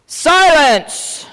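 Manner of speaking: high, booming command; loud royal bark. A narrator's reading. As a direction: bark it out at full volume. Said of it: "This part's loud".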